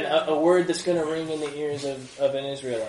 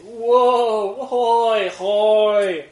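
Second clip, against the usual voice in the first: higher, louder wailing hoy hoy; a funeral procession mourning the dead; lament cry; 6 semitones higher, 9 dB louder, much slower than usual. Shown as a demonstration. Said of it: "you're saying".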